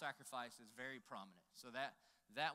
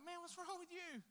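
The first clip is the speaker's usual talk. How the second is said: very high mock-child question; high kid voice. Boy turns to his crying buddy, high kid voice. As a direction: squeal it like a puzzled little boy.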